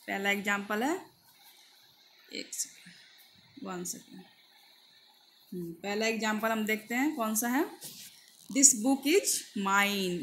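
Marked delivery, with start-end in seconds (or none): 2.3-5.7 whispered aside; quiet aside. Real, not acted. Teacher pausing the lesson, whispered aside. Aside while searching slide. 8.6-10.2 very slow dictation; very slow example read. Shown as a demonstration. Teacher reading example to students, very slow.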